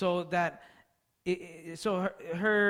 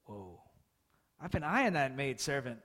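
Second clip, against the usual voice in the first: sly aside; 6 semitones lower, 5 dB quieter than usual. Retold or imitated. Voicing Abraham's hidden desire.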